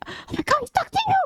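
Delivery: very high, hushed squeal; squeaky imitation of a friend's suppressed excitement; excited inside voice. Friend telling her he's talking to you, squealing in inside voice. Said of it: "she used her inside voice".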